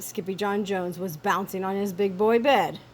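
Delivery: usual speaking voice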